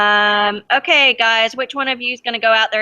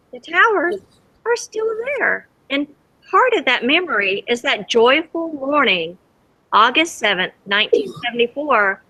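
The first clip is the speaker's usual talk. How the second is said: reading a picture book aloud to the class; lively read-aloud for kids; storybook reading; 2 semitones higher than usual. A narrator's reading. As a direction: tell it gently to children, letting the wonder show. Teacher reads story to children.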